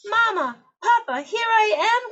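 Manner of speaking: very high, loud happy cry; joyful cry. Acted. Lost chick spots her parents, joyful high cry. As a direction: shout with relief to her parents. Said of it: "cried Pip".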